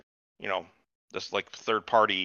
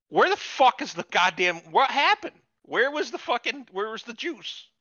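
exasperated outburst; 13 semitones higher, slower than usual. Retold or imitated. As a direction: yell at the screen in disbelief. Exasperated viewer rant quote.

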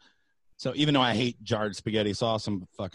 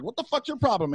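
annoyed; 9 semitones higher than usual. Retold or imitated. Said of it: "she turned around like".

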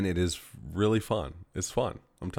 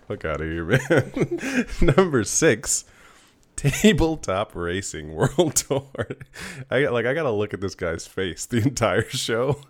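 real giggles breaking into higher-pitched talk; laughing while talking; 5 semitones higher, 5 dB louder than usual. Real, not acted. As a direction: try to read on through the laughter. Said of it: "like, laughing, and it's really distracting me".

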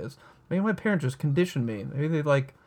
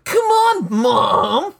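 put-on high whining child voice; whiny kid; 8 semitones higher, 6 dB louder, much slower than usual. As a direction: whine it like a bratty kid.

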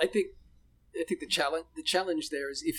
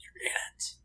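whisper; 8 dB quieter than usual. Shown as a demonstration. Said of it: "It's like".

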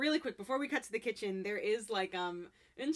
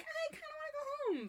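much higher, softer little plea; whiny high voice; 4 dB quieter than usual. Retold or imitated. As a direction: whine it like a homesick kid. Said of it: "she's still kinda like".

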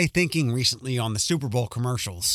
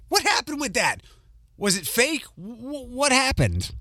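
high-pitched, bewildered questioning; baffled disbelief; 10 semitones higher than usual. Retold or imitated. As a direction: recall your own puzzlement, pitch jumping up. Retold baffled reaction to a video.

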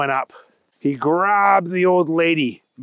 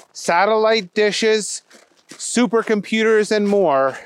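ordinary delivery, nothing marked

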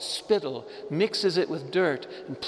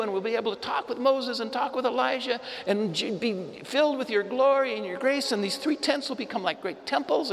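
much higher, fast, gushing babble; excited Peter; 9 semitones higher, faster than usual. Retold or imitated. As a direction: tumble over the words in giddy delight. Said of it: "who interrupts but the dunderhead Peter".

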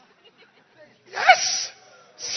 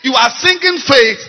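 raised, higher preaching voice; scolding preacher; 4 semitones higher than usual. Real, not acted. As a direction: call out the hypocrisy from the pulpit. Preacher breaks in, scolding singer.

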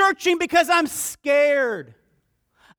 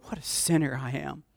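quiet confession; 10 semitones lower, 9 dB quieter than usual. Acted. Kneeling confession to God.

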